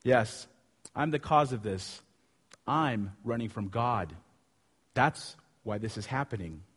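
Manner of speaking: subdued, low-voiced confession; confessing. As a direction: own up quietly, pausing between phrases. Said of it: "And Jonah confesses".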